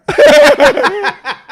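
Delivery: loud, high cackle; real burst of laughter. Real, not acted. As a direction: crack up loudly at your own joke. Cracks up at his own impression.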